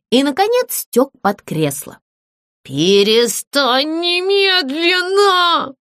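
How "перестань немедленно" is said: high, slow wailing howl; howling ghost. Acted. Ghost begs the singing puppet to stop, howling. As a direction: howl to make her stop. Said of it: "взвыл он".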